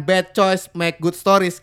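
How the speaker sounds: slow password. Shown as a demonstration. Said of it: "lalu lu katakan".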